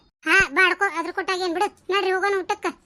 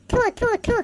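three short "thu" spitting sounds; dry, repeated spitting noise; ritual mock spitting. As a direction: spit away the evil eye three times. Ritual spitting after evil-eye chant.

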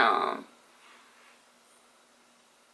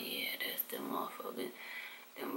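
hushed, breathy whisper of a secret; 22 dB quieter than usual. Retold or imitated. Telling her sister who spread the rumours, in a whisper. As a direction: lean in and whisper it low.

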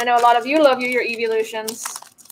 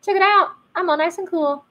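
higher, louder cocky card voice; voicing the Pokemon; 7 semitones higher, 3 dB louder than usual. Voicing Beldum on the card for viewers, high and cocky. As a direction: give the card a smug little voice. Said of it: "It's like".

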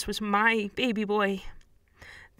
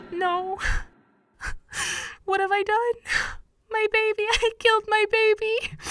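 horrified despair; 13 semitones higher than usual. Acted. Mother realizes she stabbed son.

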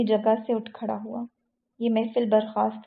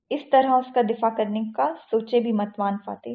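usual speaking voice

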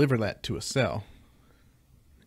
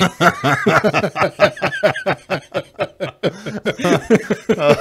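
high, loud laughter for seconds; long loud laugh; 7 semitones higher, 9 dB louder than usual. Real, not acted. Host cracking up at the handcuff punchline, long loud laugh. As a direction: crack up completely.

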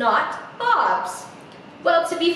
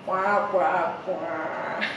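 low, sinking sad-trombone noise; playful wah-wah of disappointment; mock wah-wah; 6 semitones lower, 3 dB quieter, much slower than usual. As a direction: sound out a comic failure, dropping low. Mocking Not's failure.

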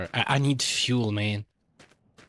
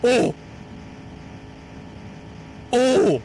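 surprised shout; 10 semitones higher than usual. Real, not acted. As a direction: yelp at the sight of a boat. Spotting the boat.

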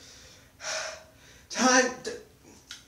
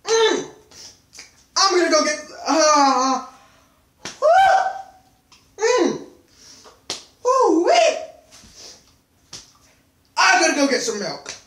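loud, strained, in pain; mouth burning from spicy food; 6 semitones higher, 10 dB louder than usual. Real, not acted. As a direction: push through a burning mouth. Mouth on fire after peppers.

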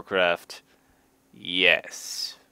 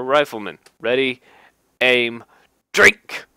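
mock firing command; 2 semitones higher, 7 dB louder than usual. Calling a mock firing squad, building to a shout. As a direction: bark the orders, then yell drink.

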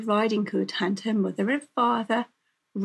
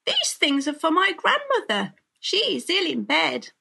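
put-on high-pitched child's protest; earnest, raised-pitch objection; little girl protesting; 8 semitones higher, 4 dB louder than usual. A narrator's reading. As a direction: plead it in a high girlish voice.

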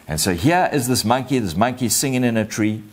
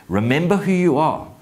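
raised, ringing command; father's charge; 7 semitones higher, 4 dB louder than usual. Acted. Father to Simba his son, raised commanding charge. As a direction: lift pitch and command it. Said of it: "He says that you are".

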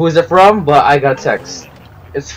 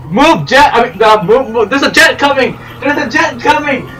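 play panic; 10 semitones higher than usual. Pretend. Driver warning the crew of a jet, play panic. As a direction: call out in pretend panic.

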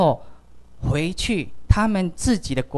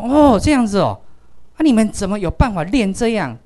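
surprised; 6 semitones higher, 7 dB louder than usual. Retold or imitated. 惊讶地听孩子回答.

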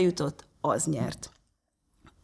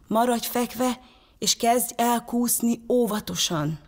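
higher-pitched, careful urgent coaching; urgent instruction; 5 semitones higher than usual. A narrator's reading. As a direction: guide him from afar, pitch raised. Brother instructs boy on cracking ice.

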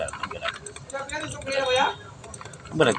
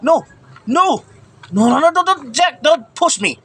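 panicked pleading; 8 semitones higher, 3 dB louder than usual. Real, not acted. Being pushed toward monster in game.

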